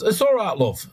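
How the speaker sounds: a quick, high-pitched Yorkshire "all right, love"; Yorkshire accent. Retold or imitated. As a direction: tease it in a light Yorkshire accent. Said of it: "I'm sorry about the accent".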